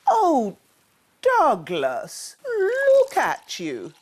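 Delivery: high, slow surprised dad voice. A narrator's reading. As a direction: fuss over the wet cub. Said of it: "asked his dad".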